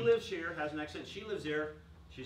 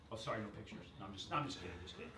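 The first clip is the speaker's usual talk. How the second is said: ordinary delivery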